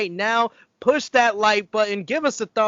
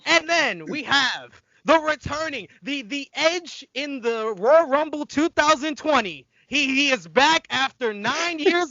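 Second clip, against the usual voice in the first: higher-pitched hype announcer; big returning-guest intro, stretched for effect; announcer introduction; 5 semitones higher, slower than usual. Real, not acted.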